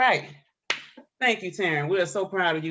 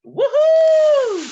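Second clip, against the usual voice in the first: very high, loud whoop; presenter cheering the winner; 13 dB louder than usual. Real, not acted.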